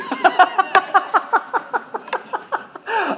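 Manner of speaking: long, high-pitched wheezing laugh. Real, not acted. Preacher after admitting he was relieved, high laughing fit. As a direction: crack up helplessly, high and sustained. Cracks up at his punchline.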